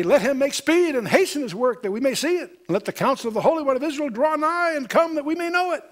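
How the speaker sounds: high mocking taunt of the wicked; sarcastic taunt. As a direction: sneer it out like scoffers daring God. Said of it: "That's a taunt. That's being said sarcastically".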